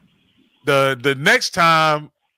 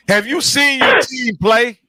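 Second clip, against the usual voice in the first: taunting shout; 7 semitones higher than usual. Real, not acted. Host taunts rival fan Edwin about his team, with a raised jeering voice. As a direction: call him out, dragging it out.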